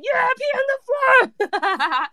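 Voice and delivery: high, slow joyful yell; excited shout. Retold or imitated. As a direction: cheer it back at full voice. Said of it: "and I'm like".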